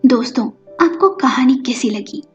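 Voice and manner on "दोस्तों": ordinary delivery, nothing marked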